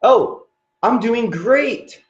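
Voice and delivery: high-pitched, drawn-out gushing; put-on phone enthusiasm; faked delight. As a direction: pretend you're doing wonderfully, high and slow. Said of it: "Fake it".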